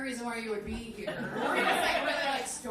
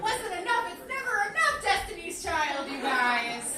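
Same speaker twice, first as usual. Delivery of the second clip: loud, high opening burst; loud hyped greeting to the crowd; excited shout-out; 6 semitones higher, 7 dB louder than usual. Real, not acted. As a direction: hit the stage fired up. Comic hyped after music ends.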